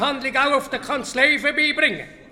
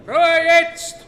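sharp call to order; 4 semitones higher, 5 dB louder than usual. Acted. Chair calls the meeting to order.

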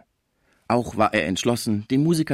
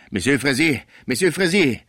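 louder, higher call, growing; urgent calling; 6 semitones higher, 5 dB louder than usual. Acted. Sauvage calling Fraisier aside, louder the second time. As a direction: grab his attention urgently.

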